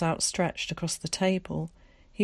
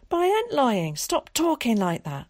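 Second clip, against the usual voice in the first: put-on higher, louder boyish protest; a boy insisting he isn't lying; 7 semitones higher, 5 dB louder than usual. A narrator's reading. Willie protesting.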